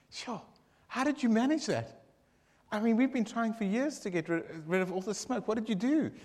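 high, lively barman's voice; amazed barman. Acted. Barman asks how the smoke vanished, high amazed voice. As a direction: marvel at the clear air.

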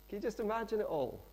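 ordinary talk

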